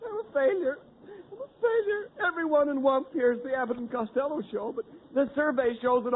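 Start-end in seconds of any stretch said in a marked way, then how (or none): 0.0-2.1 high wailing mock sobs; hammed-up crying; mock sobbing. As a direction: blubber it like a crushed salesman. Comic crying over ad survey.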